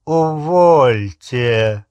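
loud, drawn-out disdain; slow and scornful; contemptuous. Acted. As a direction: dismiss it with scornful, stretched vowels. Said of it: "презрительно произнёс Яксли".

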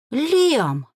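hoarse, stricken call; croaked exclamation. A narrator's reading. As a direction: croak the name in shock. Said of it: "прохрипела, прикрыв рот ладонью".

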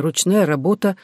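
usual speaking voice

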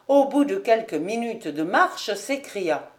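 ordinary delivery, nothing marked

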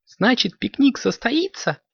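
very high, joyful question; delighted kitten. A narrator's reading. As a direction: ask high-pitched, thrilled at the news. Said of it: "обрадовалась Карамелька".

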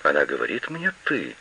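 soft, low, stunned murmur; whispered. A narrator's reading. Old woman to herself, stunned, near whisper. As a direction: say it under the breath, dazed. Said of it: "прошептала старуха".

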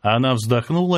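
usual speaking voice

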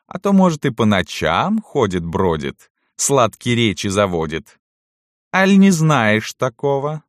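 high, sly interrogation by the lady; imperious mistress, taunting. A narrator's reading. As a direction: needle the old servant with mock sweetness. (властная барыня, язвительный допрос)

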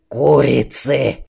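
slow puffing grumble, breathing hard. A narrator's reading. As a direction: huff it out, worn out. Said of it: "сопел король в капкане".

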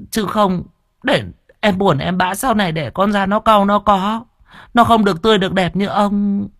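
high-pitched pleading pregnant wife; wheedling wife. A narrator's reading. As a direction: coax your husband with a plaintive, high voice. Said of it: "nằng nặc tìm ông Tránh mà xin xỏ".

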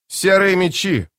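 slow, high call to the ranks; calling out to troops. Acted. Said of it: "обратился к воинам Итковиан".

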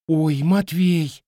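put-on slow, drawn-out girlish recognition; lightly voiced girl gasping his name; surprised girl. (удивлённо, протяжно, девичьим голосом)

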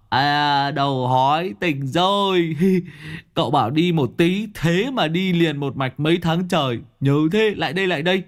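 high, gleeful buddy voice; gleeful Long. Acted. Long greets his bald old friend, high and gleeful. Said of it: "Long đầu búa hớn hở".

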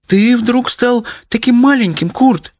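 very high, plaintive wail; plaintive mother. A narrator's reading. Mother to her suddenly shrunken husband, plaintive. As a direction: lament it high and piteously. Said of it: "жалобно сказала мать".

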